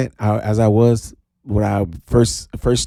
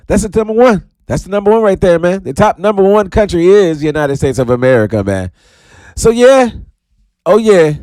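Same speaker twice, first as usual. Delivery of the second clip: loud, high hype; hype shout; 9 semitones higher, 6 dB louder than usual. Real, not acted. Hyping listeners from the top country, loud.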